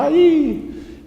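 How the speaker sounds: high, loud echo; shouted echo. Acted. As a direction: throw the cry back like an echo. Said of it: "lá no fundo gritava".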